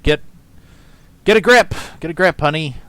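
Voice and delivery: loud, high get a grip; loud exasperated scolding. Real, not acted. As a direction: snap at her in disbelief. Exasperated outburst at streamer.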